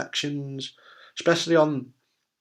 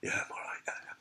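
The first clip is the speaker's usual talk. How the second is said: faint, breathless imitated patient; acted weak, voiceless reassurance; out of breath; 14 dB quieter, faster than usual. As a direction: murmur it with no wind left. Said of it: "out of breath".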